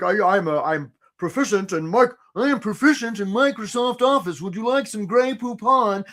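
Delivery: mock posh show-off, lightly voiced; mock stuffy applicant. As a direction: send up a snooty applicant reading his own resume. Mocking a stuffy resume cliché.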